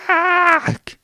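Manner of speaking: very high, loud angry cry; cursing growl. Acted. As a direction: spit out a curse. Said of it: "fluchte der Gnom".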